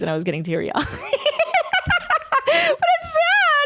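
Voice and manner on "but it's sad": very high, stretched wail, half laughing; tearful laughing wail. Real, not acted. Streamer defending getting teary-eyed to chat, wailing through laughter. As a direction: whine it out as if about to cry. Tearful over canceled murder mystery.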